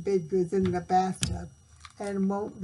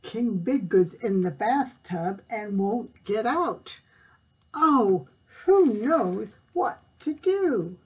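panicked page; 3 semitones higher, 7 dB louder, slower than usual. A narrator's reading. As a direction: plead as if nobody can help. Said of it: "cried the page".

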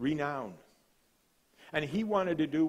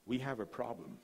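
softer, grave request; hushed sheikh; 8 dB quieter than usual. Retold or imitated. The sheikh greeting Doctor Kennedy, hushed and grave. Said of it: "he said".

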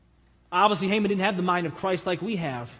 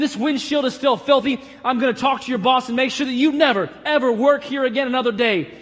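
retold scream; 4 semitones higher, 6 dB louder than usual. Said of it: "Apparently, he screamed".

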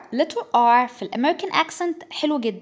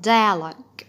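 American accent; 2 semitones lower, much slower than usual. Shown as a demonstration. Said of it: "ده British وده American".

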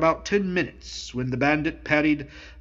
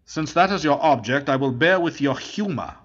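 plain reading voice

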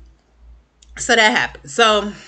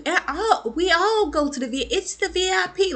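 high stammering mock excuse; fumbling excuse; 12 semitones higher than usual. Zach caught out, stammering a high excuse. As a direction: scramble for a cover story.

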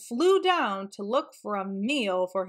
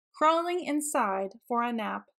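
ordinary delivery, nothing marked

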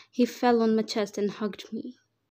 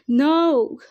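loud, high shrieked no; 5 semitones higher, 9 dB louder than usual. A narrator's reading. Mother sees her child about to be shot, shrieking. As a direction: shriek it out in horror. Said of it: "Her shriek was deafening".

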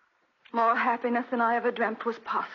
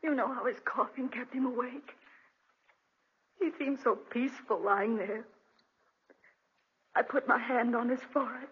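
ordinary talk

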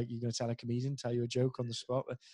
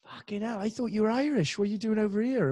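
imitating surprised locals; 9 semitones higher, 3 dB louder than usual. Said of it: "people kept going up to me going".

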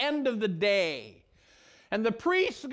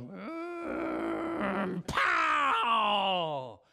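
strained hum, then a shouted pow; a stone idol slamming to the floor; crash sound effect. Acted. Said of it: "And this tone goes".

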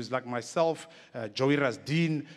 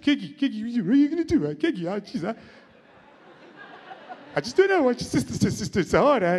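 Ronnie Aptieka; 8 semitones higher, 4 dB louder, faster than usual. Retold or imitated.

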